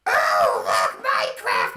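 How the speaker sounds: put-on low, monstrous goblin-kid shout; goblin child. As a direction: holler like a little goblin overjoyed at a game. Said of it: "two little goblin children come into the GameStop going".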